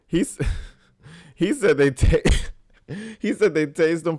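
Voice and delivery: laughing disbelief. Real, not acted. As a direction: laugh through the words in disbelief.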